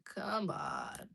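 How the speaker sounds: usual speaking voice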